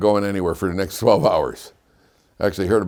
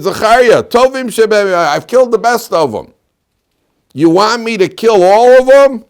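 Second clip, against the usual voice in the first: high, loud, challenging appeal; exasperated shout; 13 semitones higher, 10 dB louder than usual. Acted. Nebuzaradan challenging Zechariah's boiling blood, exasperated shout. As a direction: demand an answer at full voice.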